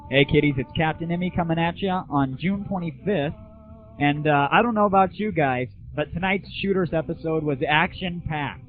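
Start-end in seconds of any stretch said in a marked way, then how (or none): none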